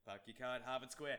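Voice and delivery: put-on laughing Boston accent; mock Boston accent. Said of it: "he's gonna do a Boston accent".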